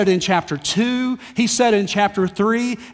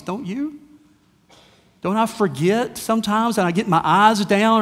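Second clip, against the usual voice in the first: ordinary talk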